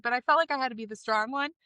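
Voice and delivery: ordinary talk, nothing marked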